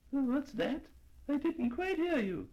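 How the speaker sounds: put-on high, hard-of-hearing old lady; a high old lady straining to hear; deaf old lady.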